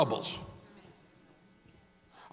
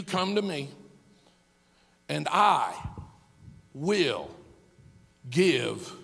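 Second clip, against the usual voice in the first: gentle invitation; 3 dB quieter, slower than usual. Acted. Pastor voicing God's gentle invitation.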